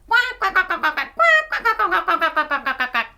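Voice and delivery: playful quacks tumbling down in pitch; duck quacking. Pretend. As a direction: quack excitedly like a flock of ducks. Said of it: "quacking with the three little ducks".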